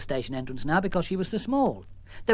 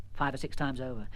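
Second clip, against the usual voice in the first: ordinary delivery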